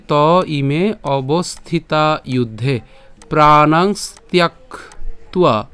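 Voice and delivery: slow, clear chant of the shloka; slow verse recitation. Shown as a demonstration. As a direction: recite each syllable carefully for learners. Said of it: "আমি আবার বলছি". (ধীরে স্পষ্ট শ্লোক পাঠ)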